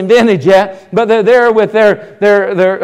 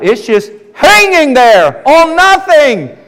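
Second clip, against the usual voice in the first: higher, slow, hammed-up awe; drawn-out wonder; 6 semitones higher, slower than usual. Preacher voicing astonished scientists, slow hammed-up awe. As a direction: stretch it out in mock disbelief. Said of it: "it's like".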